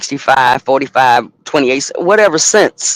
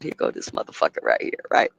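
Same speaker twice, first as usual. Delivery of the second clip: whispered aside; 11 semitones lower, 9 dB quieter than usual. Retold or imitated. Muttering aside during the call.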